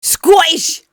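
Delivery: a high, hammed-up Scottish exclamation; a playful Scottish accent, pitched high. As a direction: blurt it in a mock Scots accent. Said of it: "how do you do Scottish?".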